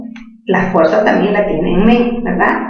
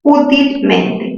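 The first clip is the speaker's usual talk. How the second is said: "Útilmente" very slow, higher, stress on Ú; slow stressed word; 4 semitones higher, much slower than usual. Shown as a demonstration. Teacher sounds out "Útilmente" for students. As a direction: draw out "Útilmente" leaning on Ú. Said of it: "¿dónde tiene el acento útilmente?".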